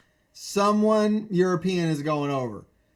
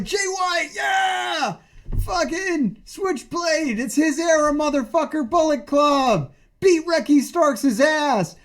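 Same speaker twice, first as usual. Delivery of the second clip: high, hyped yelling fan; excited fan cheer; 7 semitones higher, slower than usual. Retold or imitated. Retelling his own hype at the reveal, high cheering. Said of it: "I was like".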